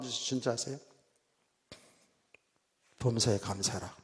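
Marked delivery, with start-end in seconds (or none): 3.0-3.9 lower-pitched inner voice, lightly acted; voice of God. God speaking into the praying preacher's heart, gently voiced. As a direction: quote a divine prompting, low and restrained. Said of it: "내 마음에 하나님의 음성이 들려오는".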